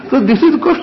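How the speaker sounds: laughing at own joke. Real, not acted. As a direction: say it through laughter.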